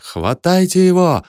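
high, drawn-out alarm cry; urgent high-pitched shout; crowd's yelling. A narrator's reading. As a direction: call out for someone to seize him. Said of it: "орали они".

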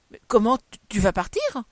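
loud, stammered disbelief; breath-caught, shocked exclamation; choked cry. A narrator's reading. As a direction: gasp it out, aghast. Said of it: "cria Gabrielle suffoquée".

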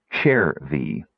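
"chair V" in two slow, separate parts; ch sound. Shown as a demonstration. Narrator showing the girl's pronunciation, slow "chair V" with ch. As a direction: spell out the sound slowly: "chair V". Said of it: "pronounced this with a ch sound".

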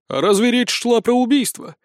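high, knowing question; hint that you have a cunning way round it; sly. Acted. Sergeant hints at another plan, slyly. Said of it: "хитро подмигнув".

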